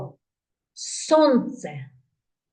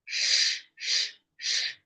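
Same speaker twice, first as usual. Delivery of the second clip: soft voiceless sh puffs; hissing shh; 7 dB quieter than usual. Shown as a demonstration. Showing children the rocket flame: soft shh puffs. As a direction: hiss like rocket fire. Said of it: "Як вогонь вилітає з хвоста".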